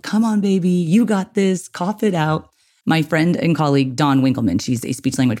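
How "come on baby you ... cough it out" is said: put-on high, sing-song cooing to a baby; fake baby voice. Shown as a demonstration. Parent cheering on a gagging baby, high cooing baby voice. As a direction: coax the baby like talking to an infant. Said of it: "fake baby voice on and say positive things like".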